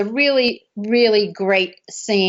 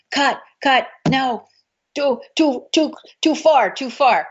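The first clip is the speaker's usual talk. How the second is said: retold yell; 4 semitones higher than usual. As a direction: shout the cut at the stunt crew. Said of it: "he would yell".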